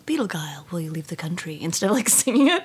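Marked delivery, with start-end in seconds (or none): 0.1-1.6 dramatic whisper; whispered line. Retold or imitated. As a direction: breathe the words out unvoiced. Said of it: "Shatner style".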